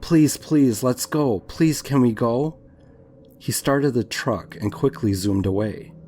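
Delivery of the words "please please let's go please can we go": higher, louder urgent begging, no real sobs; distressed pleading. A narrator's reading. Woman begging her ex to drive off, mildly tearful. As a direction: beg with a touch of tears. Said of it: "I was sobbing uncontrollably".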